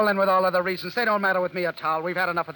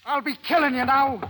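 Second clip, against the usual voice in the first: higher and louder, threatening; murderous rage; 9 semitones higher, 4 dB louder than usual. Acted. Matt threatens Anna after her confession, murderous rage. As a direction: threaten her in raw fury.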